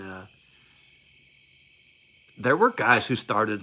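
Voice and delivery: usual speaking voice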